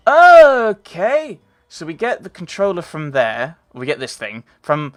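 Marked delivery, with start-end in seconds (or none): 0.1-1.4 thrilled at the news, burst out; excited gasp. Real, not acted. Gamer thrilled by the car offer, excited shout. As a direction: gasp then a high, loud "Okay!".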